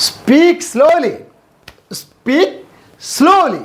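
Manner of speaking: high-pitched, very slow and fully voiced; drawn-out high demonstration; voiced teaching model. Shown as a demonstration. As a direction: call it out high, word by word. Same phrase modelled aloud, high.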